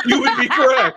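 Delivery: mock formal. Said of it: "And I'm like".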